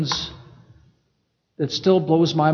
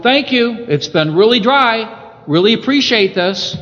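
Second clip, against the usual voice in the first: higher and louder, playful thankful grass voice; grateful grass; 5 semitones higher, 5 dB louder than usual. Pretend. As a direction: voice the thirsty grass, happily grateful. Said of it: "if I was the grass, I'd be going".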